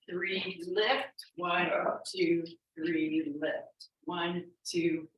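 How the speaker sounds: ordinary delivery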